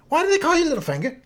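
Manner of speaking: put-on high, fast, accusing kid voice; young Arya blurting. Arya blurts out her question to Littlefinger, high and accusing. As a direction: belt it out like a blunt little girl. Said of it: "Young Arya has a way of asking a question that seems accusatory".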